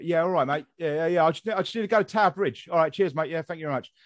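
quick, chatty London accent. Shown as a demonstration. Visitor asking for Tower Bridge, London accent. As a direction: chat like a London local, matey. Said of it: "go to London and I talk a bit".

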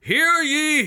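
loud, high proclamation; town crier. Acted. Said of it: "voices that are supposed to be recognizable".

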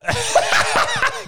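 squealing high-pitched laughter; high shrieking laugh. Real, not acted. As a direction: burst into high giggles. Laughing at Dan's reaction.

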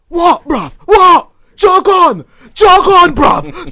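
high, loud furious shout. Retold or imitated. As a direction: yell at the cyclist to get lost. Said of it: "he just screams at the guy".

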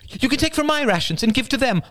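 high, loud terrified plea; mother begging to share rations; forceful pleading. A narrator's reading. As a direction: press hard, frightened. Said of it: "with sudden force".

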